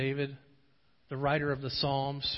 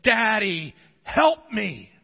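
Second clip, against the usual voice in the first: loud, high cry for help; desperate child's cry; 7 semitones higher, 10 dB louder, much slower than usual. Acted. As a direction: call out to Daddy like a frightened child. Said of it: "the cry of a desperate child".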